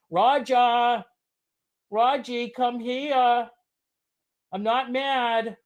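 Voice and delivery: high, drawn-out call to the dog. Acted. Streamer calling his dog to come to him, high and slow.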